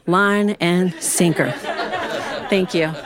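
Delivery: usual speaking voice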